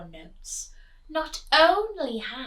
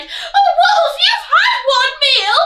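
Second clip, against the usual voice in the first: high, loud squealing pig; frantic piglet squeal; pig crying out; 15 dB louder than usual. Acted. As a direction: squeal it in panic. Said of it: "He cried".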